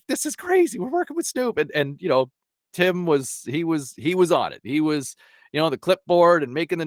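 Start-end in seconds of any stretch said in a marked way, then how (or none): 0.1-1.6 much higher, thrilled half-whisper; barely containing the excitement; retold fanboy thrill; giddy disbelief. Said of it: "I'm like".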